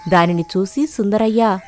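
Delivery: usual speaking voice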